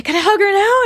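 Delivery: high, loud pleading question; eager boy asking. Retold or imitated. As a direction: beg like a bouncing little boy. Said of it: "And he'd say".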